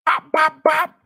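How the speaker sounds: loud high bop bop bop; gunshot mimicry. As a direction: fire off the shots with your mouth. Imitating gunshots.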